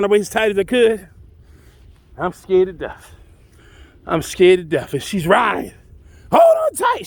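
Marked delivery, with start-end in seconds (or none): 6.3-7.1 high, loud call over the hoofbeats; high shouted warning. Retold or imitated. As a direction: yell it up to the rider behind her. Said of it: "she says".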